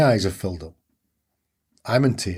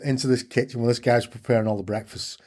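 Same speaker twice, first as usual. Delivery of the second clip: ordinary delivery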